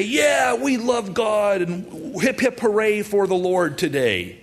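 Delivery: high, gushing, hammed-up cheer; exaggerated high-pitched devotion; mock enthusiasm. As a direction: cheer like a crowd all talk and no action. Mimics people's empty talk of devotion.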